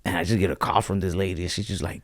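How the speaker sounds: usual speaking voice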